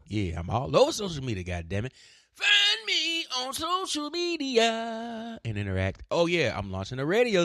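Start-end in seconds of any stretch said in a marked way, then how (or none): 2.3-5.4 high, slow, drawn-out holler; exaggerated high-pitched plea to followers; hammed-up plug. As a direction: stretch each word out in a high, silly call. Hammed-up plug for his accounts.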